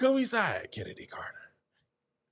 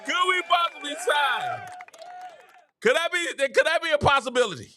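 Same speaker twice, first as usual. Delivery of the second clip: mock excitement; 10 semitones higher than usual. Excited joke pitch to viewers.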